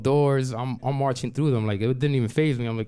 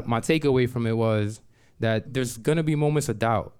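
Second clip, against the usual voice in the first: ordinary delivery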